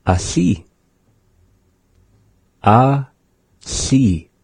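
"asi" said slowly with the s as a lateral hiss; careful teacher demo of "asi"; pronounce asi. Shown as a demonstration. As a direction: model the word "asi" for learners, air out the side of the tongue. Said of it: "let's begin with the word".

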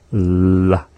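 La with air hissed past the tongue sides; La as a voiceless lateral fricative. Shown as a demonstration. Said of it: "That is the L H".

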